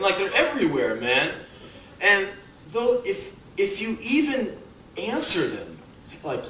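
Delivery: usual speaking voice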